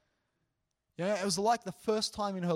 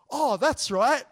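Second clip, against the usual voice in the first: higher, loud happy exclamation; imitated bright realisation; 7 semitones higher, 12 dB louder, slower than usual. Student told she is a leader, imitated loud delighted realisation. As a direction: act out a student suddenly cheered. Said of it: "her face lit up".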